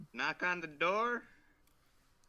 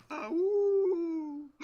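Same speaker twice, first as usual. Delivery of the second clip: high, loud howling call; coyote call; 5 dB louder than usual. Pretend. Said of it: "I'm gonna go".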